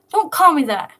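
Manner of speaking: loud, high, snapping back at a nickname; sharp protest. Acted. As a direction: bark it out, offended. Will's nickname angers Nico.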